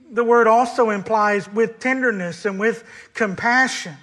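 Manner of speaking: impassioned sermon voice; preaching at full force. Acted. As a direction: drive the point home to the congregation.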